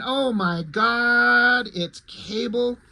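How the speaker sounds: slow, drawn-out mock amazement; hammed-up sarcastic surprise; sarcastic fake shock. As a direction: gasp it out like nobody saw it coming. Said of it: "it's such a shock. No, it's not".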